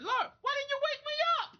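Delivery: hammed-up cry to the heavens; pleading to God. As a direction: mock-desperate complaint to the Lord. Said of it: "I said".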